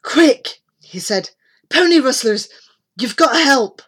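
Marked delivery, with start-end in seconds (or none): 0.0-0.6 high, loud alarm; urgent raised call. A narrator's reading. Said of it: "all out of breath". 1.7-3.8 higher, loud plea for help; pressing boy's cry; urgent alarm. A narrator's reading. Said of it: "all out of breath".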